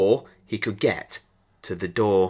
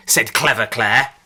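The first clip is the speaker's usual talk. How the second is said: plain reading voice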